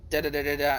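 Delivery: normal talking voice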